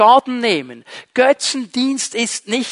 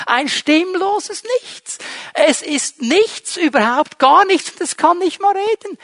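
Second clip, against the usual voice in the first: high mocking voice, sneering at nothing; 8 semitones higher than usual. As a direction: scoff at the empty idol, pitched up.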